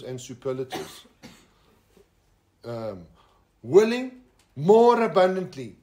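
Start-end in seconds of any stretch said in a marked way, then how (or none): 3.6-5.8 louder and slower, leaning on more; stressed for emphasis. Shown as a demonstration. Preacher to congregation, stressing willing more abundantly. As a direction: savour each word of the phrase. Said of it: "That's a double positive".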